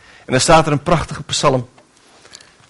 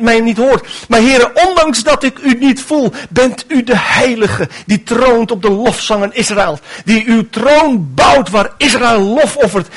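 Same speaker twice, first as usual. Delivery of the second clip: heartfelt prayer; 6 semitones higher than usual. Acted. Preacher prays as believer.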